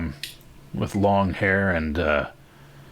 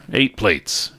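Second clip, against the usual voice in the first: raised, higher voice; calling out an order; 5 semitones higher, 6 dB louder than usual. Acted. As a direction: call it out to the kitchen. Sheriff calls out food order.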